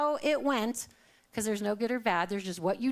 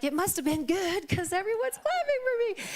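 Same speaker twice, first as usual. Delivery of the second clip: much higher amused marvel; mock surprise; 9 semitones higher than usual.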